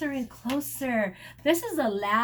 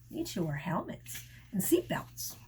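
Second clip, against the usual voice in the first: whisper; 6 semitones lower, 6 dB quieter than usual. Real, not acted. Aside to child.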